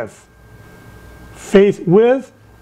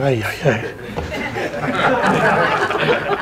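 mock dismayed groan; 4 semitones lower, 6 dB quieter, much slower than usual. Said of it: "I'm thinking".

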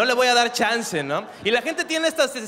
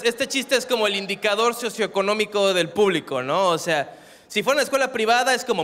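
ordinary delivery

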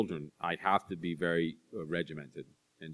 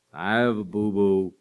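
put-on toddler voice; 6 dB louder than usual. Toddler calling down to parents from bed, slow kid whine. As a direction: play the toddler calling from her crib.

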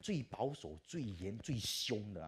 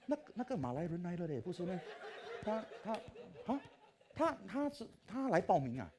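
high, laughing disbelief; amused shocked clerk; startled registrar; 11 semitones higher, 4 dB louder than usual. Retold or imitated. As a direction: gasp at the long-haired guy. Staff startled by long-haired man.

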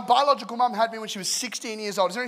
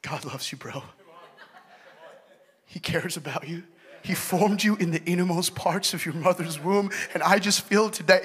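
American preacher. Shown as a demonstration. Showing an American sounds better preaching, American accent.

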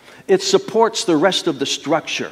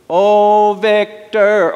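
a half-hearted call-out, louder and higher; calling a friend out; 5 semitones higher, 5 dB louder, much slower than usual. Retold or imitated. Boy calling his friend Victor out of the house, mock yell. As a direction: sing out a friend's name from the street. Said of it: "Only I'd shout it really loud".

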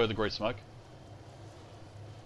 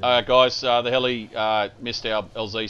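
calling out; 9 dB louder, slower than usual. Real, not acted. Calling out to squad in field.

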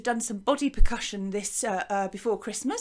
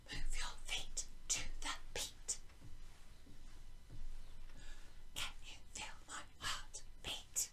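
whisper; 17 dB quieter than usual. Shown as a demonstration. Teacher whispers the hello echo for pupils to copy. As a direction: whisper it so they lean in to hear. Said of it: "I bet you'll guess what this is".

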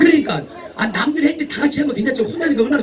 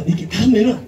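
normal talking voice

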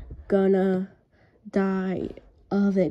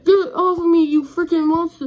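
panicked yell; 7 semitones higher, 6 dB louder than usual. Acted. Attacked by a monster.

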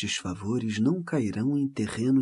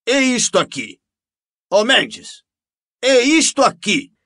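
plain reading voice

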